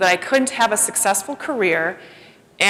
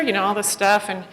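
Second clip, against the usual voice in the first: ordinary talk, nothing marked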